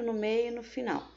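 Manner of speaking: ordinary delivery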